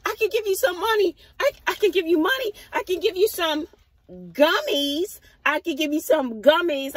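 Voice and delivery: high, rushed imitation of her offers; pleading other driver. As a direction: imitate someone anxiously offering amends. Imitating apologetic driver offering compensation.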